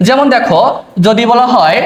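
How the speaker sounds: normal talking voice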